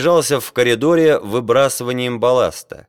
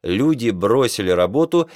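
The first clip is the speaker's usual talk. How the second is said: ordinary delivery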